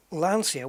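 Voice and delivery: Lancia as LAN-see-a; common mispronunciation. Shown as a demonstration. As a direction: say Lancia with an s sound. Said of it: "we always say Lancia wrong".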